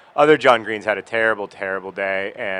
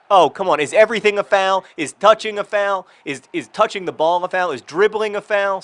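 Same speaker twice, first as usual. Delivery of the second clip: higher, louder exasperated protest; upset at the referee; 8 semitones higher, 5 dB louder than usual. Real, not acted. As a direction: complain to the ref in disbelief. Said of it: "I'm upset with the referee".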